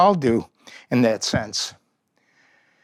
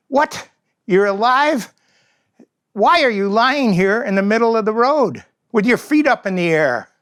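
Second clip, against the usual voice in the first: high, incredulous raptor voice; 7 semitones higher, 3 dB louder than usual. Acted. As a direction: sneer down at the bird, much higher.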